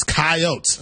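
"coyotes" said as kai-OATS, two syllables; local coyotes. Shown as a demonstration. Host explaining the local name to the guest, two-syllable coyotes. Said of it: "That's how they pronounce it up here".

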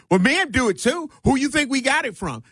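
high-pitched, mock-indignant comeback; facetious retort. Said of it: "said in a facetious type manner".

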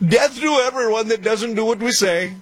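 high, hammed-up Iranian-accented chant; mock Iranian accent. Host making fun of the way they operate, mock Iranian accent. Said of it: "making fun of his accent".

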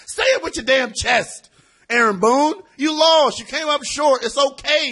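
high-pitched, emphatic rebuke; forceful exhortation. Pretend. Host loudly urging losing manager on.

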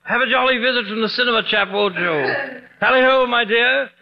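mock the fake Brit back; mocking British accent. Doctor mocks the fake British host.

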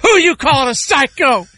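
high, mock-angry challenge; movie bar confrontation. Kingpin scene at the bar turning to the guy, mock confrontation. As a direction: round on him in a high, heated voice. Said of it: "he turns to the guy".

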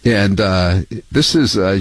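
ordinary talk

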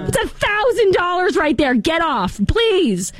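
mock-panicked parent pleading; exasperated homeowner. Homeowner dad telling daughter off the sprinkler, high exasperated plea. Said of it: "I would be like".

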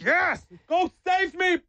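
high, slow shout after the dog; hammed-up rescue cry; mock heroic cheer. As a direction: cheer it off like a melodrama. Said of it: "I'm like".

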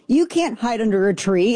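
usual speaking voice

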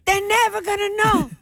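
high-pitched mock Italian accent; imitated Italian father. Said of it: "your father's like".

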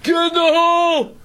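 mock fan holler. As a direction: holler like a heckler proud of himself. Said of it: "first person to ever say that".

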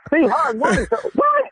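high-pitched, shocked questioning; stunned disbelief. Real, not acted. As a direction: yelp it as if you can't believe it. Victim learns it was a prank.

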